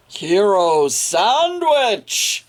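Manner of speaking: slow, drawn-out flourish; proud reveal. A narrator's reading. Reading the happy ending, drawn out proudly. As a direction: savour the new name. Triumphant reveal of new name.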